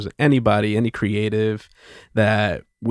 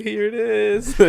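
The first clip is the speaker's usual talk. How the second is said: high-pitched taunting call; teasing singsong; 13 semitones higher, slower than usual. Acted. Joking relatives holding napkins out the window, high teasing singsong.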